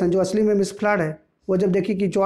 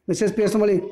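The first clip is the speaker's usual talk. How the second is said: plain reading voice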